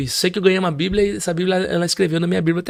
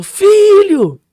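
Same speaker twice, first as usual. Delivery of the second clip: high, loud imitated shout; piercing mother's shout of relief; mother's cry; 11 dB louder than usual. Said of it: "Aqueles gritos que ela dava".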